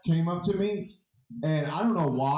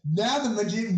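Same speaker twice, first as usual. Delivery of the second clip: ordinary talk, nothing marked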